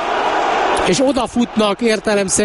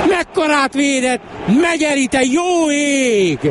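amazed exclamation; 9 semitones higher than usual. Real, not acted. Amazed at the save.

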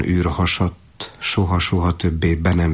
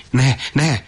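higher, louder sudden cry; soldier crying out; 6 semitones higher, 3 dB louder than usual. Acted. Soldier crying out to stop his younger brother. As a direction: stop him in alarm. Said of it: "kiáltott fel a katona is".

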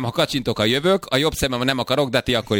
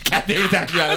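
higher, laughing mock reassurance; laughing through it; 3 semitones higher than usual. Co-host joking about Gyula's usual Tuesday, laughing through it. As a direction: crack up while saying it, dragging the last words.

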